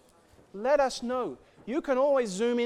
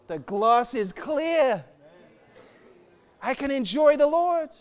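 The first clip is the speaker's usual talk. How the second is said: joyful relief; 6 semitones higher, 5 dB louder, slower than usual. Real, not acted. Joyful release after confession.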